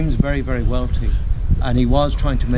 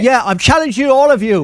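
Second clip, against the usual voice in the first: loud sharp voice; 11 semitones higher, 4 dB louder than usual. Real, not acted. Shouting back at trespasser.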